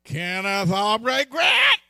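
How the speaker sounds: loud, slow, full-name scold; mad wife scolding. Retold or imitated. Sister Grant calling her husband out, angry and loud. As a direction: call him out like an angry wife. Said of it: "Her voice goes up and she uses all three".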